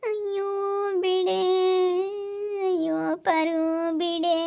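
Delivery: high, stretched-out wail of pain; tearful wailing. Acted. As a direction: beg weakly between long moans. Acted patient wailing, begging for release. (ಅಳುತ್ತಾ ನರಳುತ್ತಾ)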